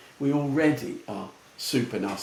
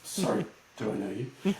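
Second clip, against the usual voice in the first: softer, subdued reserved question; politely puzzled; 3 semitones lower, 5 dB quieter than usual. Retold or imitated. Customer replying to an over-friendly US greeter, politely puzzled. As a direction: imitate a wary customer, quietly.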